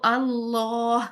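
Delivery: اللہ drawn out with a full dark lam; heavy lam. Shown as a demonstration. Teacher repeating the heavy lam in اللہ. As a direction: say اللہ slowly, thick لام. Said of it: "اللہ کے لام کو موٹا پڑھیں گے".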